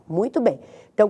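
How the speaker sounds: usual speaking voice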